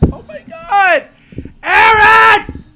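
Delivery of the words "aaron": high, loud, near-crying calls; real desperate cries; desperate calling. Real, not acted. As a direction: yell for Aaron with rising desperation. Calling desperately for absent Aaron.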